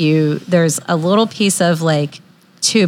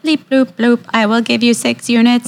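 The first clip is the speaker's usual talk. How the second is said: beeping machine voice; robot voice; 4 semitones higher than usual. Pretend. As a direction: play a beeping pager robot. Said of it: "So it's like".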